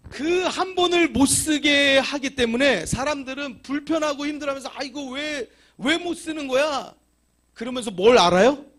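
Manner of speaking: high, loud preacher's shout; fervent preaching. Acted. Pastor presses the lesson on the congregation, shouting. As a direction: drive the point home to the pews.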